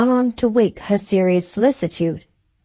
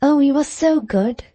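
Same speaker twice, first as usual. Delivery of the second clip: bright, delighted exclamation, pitched higher; delighted cry; 6 semitones higher than usual. A narrator's reading. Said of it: "she cried, delighted".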